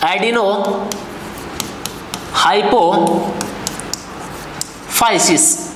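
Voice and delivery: एडिनोहाइपोफाइसिस drawn out very slowly, syllable by syllable, almost whispered. Shown as a demonstration. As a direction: spell out एडिनोहाइपोफाइसिस slowly and softly for students to copy. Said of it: "इसको बोला जाएगा". (धीरे-धीरे, अक्षर-अक्षर करके, लगभग फुसफुसाते हुए एडिनोहाइपोफाइसिस)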